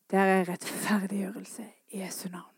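a soft, hushed whisper after the shouting. Acted. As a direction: drop to a whisper, like a secret. Sudden hush after shouting.

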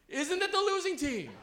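high, loud mock cheer; cheerleader taunt. Cheerleaders look over at the benched visitors, high taunting chant. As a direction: pitch it up like a jeering cheerleader. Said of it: "And they'd say".

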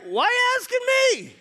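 high, loud why me; mock indignant surprise; baffled protest. Philip answering Jesus' question, high baffled yelp.

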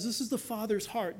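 ordinary talk

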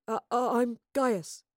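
hesitant, stumbling self-introduction; nervous stammer. A narrator's reading. Young man meets the professor, stammering nervously. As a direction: stammer shyly through your own name. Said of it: "he stammered nervously".

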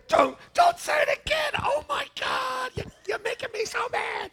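very high, hammed-up mock shriek; exasperated outburst. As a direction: act out losing it with your wife, voice shooting up. Re-enacting outburst at his wife.

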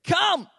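emphatic, high, loud COME; urgent one-word command on COME; emphatic call. Acted. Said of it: "what we call emphatic".

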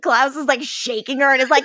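ordinary delivery

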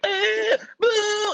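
very high, hammed-up squeals; manic wild yells. Guest wired on sugar and Red Bull, manic squealed yells. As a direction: let out crazed sugar-rush noises. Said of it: "even worse than I am now".

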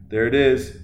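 ordinary talk

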